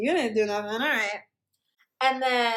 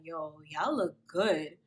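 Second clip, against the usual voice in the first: deep imitation of Kenny; 7 semitones lower, slower than usual. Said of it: "He's like".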